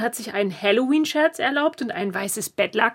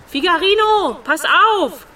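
loud urgent warning; shouted warning; 6 semitones higher, 6 dB louder than usual. Acted. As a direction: yell a warning. Warning friend of ghost.

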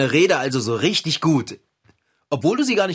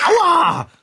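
hoher, lauter Schrei; Schmerzensschrei; 14 semitones higher, 7 dB louder than usual. Acted. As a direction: schrei auf, weil der Kater zubeißt. Kater beißt zu. (lauter, hoher Schmerzensschrei)

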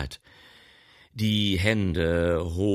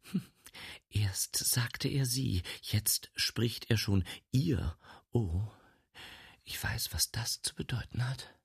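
whispering; 5 semitones higher, 4 dB quieter than usual. Acted. Inner thoughts of the spinster.

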